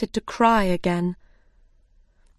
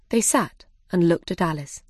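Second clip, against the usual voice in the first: ordinary delivery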